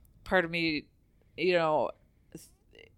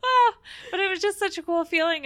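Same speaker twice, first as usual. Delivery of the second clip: laughing through tears, voice wobbling; fighting tears, high and teary; holding back tears; 10 semitones higher, 11 dB louder than usual. Real, not acted. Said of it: "'Cause I'm trying not to cry".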